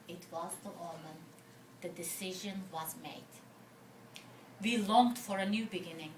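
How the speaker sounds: poem reading. Acted. Poet telling the audience of the omen, hushed recital. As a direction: drop right down for the turning point.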